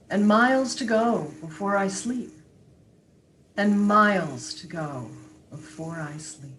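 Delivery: measured recital sinking on the last line; poetry reading. Acted. Guest closing the wintry poem, measured recital. Reads a Frost poem aloud.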